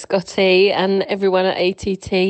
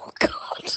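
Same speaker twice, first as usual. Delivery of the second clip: embarrassed squeal; 9 dB quieter than usual. Real, not acted. Caller squeals recalling dead hamster.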